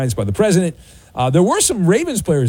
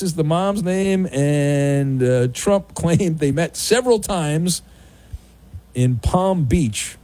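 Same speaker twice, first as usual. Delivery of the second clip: ordinary talk, nothing marked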